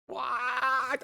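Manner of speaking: astonished. Real, not acted. Amazed at new AI model.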